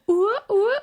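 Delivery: very high, loud ooh ah cheer. Retold or imitated. Mom cheering toddler's potty success, very high and loud. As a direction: whoop it up way up high for a potty win. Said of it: "she does it for everything".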